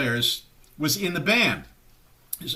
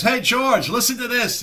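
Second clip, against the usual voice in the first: higher, eager retold call; excited phone call; 7 semitones higher, 4 dB louder than usual. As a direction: act out grabbing someone's attention by phone.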